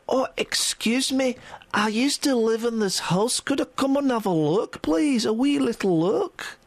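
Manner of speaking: put-on Scottish accent. Sweet young woman asks to look round her old house, Scottish accent. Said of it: "she's got a Scottish accent".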